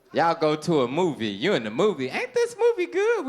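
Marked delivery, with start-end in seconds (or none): 2.1-3.3 very high, sweet question; high woman's voice. Acted. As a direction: ask like the date, high. Woman at the movie.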